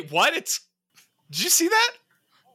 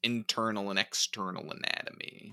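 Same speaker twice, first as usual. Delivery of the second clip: odd quiet deadpan; 6 dB quieter than usual. Real, not acted. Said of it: "Why would you say it like that?".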